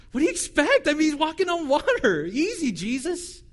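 a teasing laugh at how easy it looked for Jesus; chuckling, mock-indignant. Real, not acted. Joking complaint about Jesus' rebuke.

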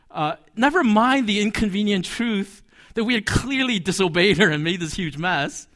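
ordinary talk, nothing marked

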